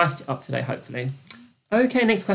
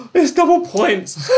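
much higher, loud, slow half-sob of excitement; thrilled announcement; 10 semitones higher, 6 dB louder, slower than usual. Acted. Host announcing double points to players, overexcited. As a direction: wail it out with glee.

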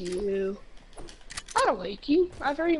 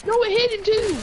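high, loud frustrated yell; 11 semitones higher, 7 dB louder, faster than usual. Real, not acted. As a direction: yell it at the screen. Yells at the game.

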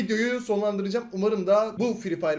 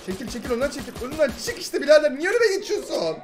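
rising, louder and higher angry shouts at a teammate; angry yelling; 7 semitones higher, 7 dB louder than usual. Real, not acted. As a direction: yell him out of the way, getting higher. Teammate blocking him in game. (takım arkadaşına giderek yükselen öfkeli bağırış)

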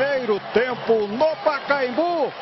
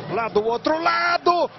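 explosive goal commentary; 3 semitones higher than usual. Real, not acted. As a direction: belt out the goal. Own goal call.

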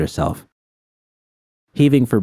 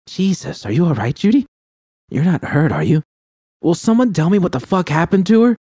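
higher, urgent worried voice; 11 semitones higher than usual. A narrator's reading. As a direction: press her, anxious and sharp. V worried, demanding answers.

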